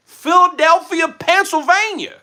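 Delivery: put-on high, loud, drawn-out wrong accent for Philadelphia; hammed-up mistaken hometown accent. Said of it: "That's the wrong accent".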